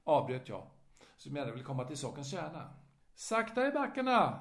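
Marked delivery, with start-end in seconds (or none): 3.2-4.4 put-on higher, louder calming old man; old man slowing him down. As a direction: hold him back like a fussy old gent. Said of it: "sa F och höjd avvärjde handen".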